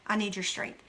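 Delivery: low, soft confession of weakness; humble prayer. Acted. Laying pride down before God, humbly and quietly. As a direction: surrender pride, dropping to a hush. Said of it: "That's being humble".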